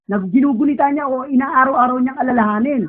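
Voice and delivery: ordinary delivery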